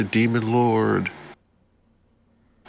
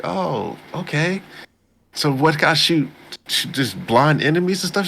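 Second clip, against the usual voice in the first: Kazuki; 6 semitones higher than usual. Retold or imitated. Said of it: "and he's like".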